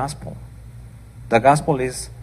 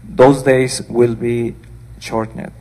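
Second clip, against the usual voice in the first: louder, declaiming Bible reading; scripture reading; 4 dB louder than usual. Acted. Preacher reading the Gospel aloud to her congregation, declaiming. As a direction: read it out to the church with weight.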